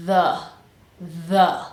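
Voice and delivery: "The" with buzzing TH then schwa; vibrating TH. Shown as a demonstration. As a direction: isolate THE, tongue between teeth, ending in "uh". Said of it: "tongue between the teeth, vibrating, and then schwa".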